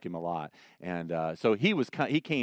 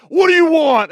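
gruff clerk, much louder and higher; 14 dB louder than usual. Retold or imitated. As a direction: growl it at the stumped customer. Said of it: "with a real gruff voice".

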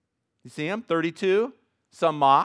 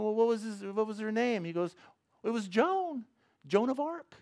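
lightly voiced, higher joke punchline; kid and dad joke voices; 8 semitones higher, 4 dB quieter than usual. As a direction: deliver the punchline a bit higher.

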